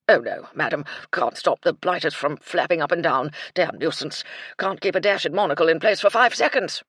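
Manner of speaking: put-on loud, hearty old officer; blustering colonel. Colonel welcoming afternoon tea, loud blustering cheer. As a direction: boom it like a cheerful old soldier.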